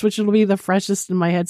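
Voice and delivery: ordinary talk, nothing marked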